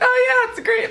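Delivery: squeaky mock-agreeable voice; high-pitched mimicry. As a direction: imitate her in a high, loud falsetto. Imitating her casual reply in the story.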